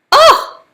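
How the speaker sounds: sharp loud high "Ah!"; venting at the unfairness of the medic tent; frustrated yell. Real, not acted. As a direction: let out a burst of exasperation. Frustrated outburst.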